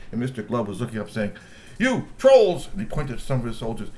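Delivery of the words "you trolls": high, loud summons; angry call. Acted. Mister Glove shouts at his soldiers, high and loud.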